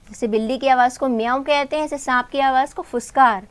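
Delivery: ordinary talk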